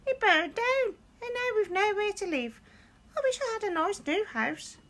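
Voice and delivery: very high squeak; squeaky mouse voice. A narrator's reading. Mother mouse squeaks to George about her burned house. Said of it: "squeaked the mother mouse".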